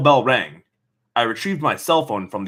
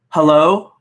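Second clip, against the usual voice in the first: friend answering; 5 semitones higher, 7 dB louder than usual. Acted.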